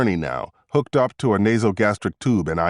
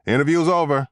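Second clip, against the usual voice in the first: raised-pitch, final announcement; yelling back; 6 semitones higher than usual. A narrator's reading. Ambulance driver cutting off the questioning, mild yell. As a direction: call it back to end things. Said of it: "the ambulance driver yelled back".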